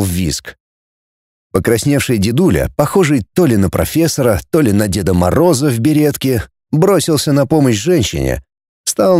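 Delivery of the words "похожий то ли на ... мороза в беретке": usual speaking voice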